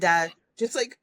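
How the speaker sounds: usual speaking voice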